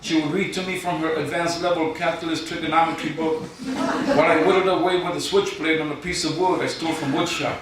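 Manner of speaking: deadpan spoken-word poem read to a crowd; poem recital. Acted. Poet reading his contest entry to the judges, mock-solemn recital.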